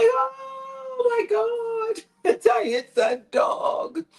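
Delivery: high wailing cry of shock, retold; mock sobbing shout of horror; wife finding the dead dog, crying out high. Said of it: "And she's crying".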